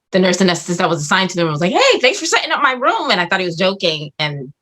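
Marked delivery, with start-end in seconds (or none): 1.7-3.1 higher singsong greeting; imitated breezy thanks. Anesthetist thanking her for the room, imitated breezy thanks. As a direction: mimic a chirpy colleague. Acts out colleague's teasing greeting.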